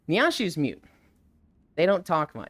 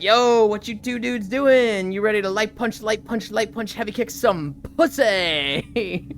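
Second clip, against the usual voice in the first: louder, slow tough-guy voice; swaggering fighter voice; 4 semitones higher, 3 dB louder than usual. Retold or imitated. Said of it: "Akuma comes up and is like".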